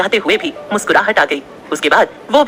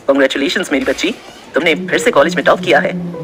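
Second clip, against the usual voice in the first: teacher's voice; 6 semitones lower than usual. A narrator's reading.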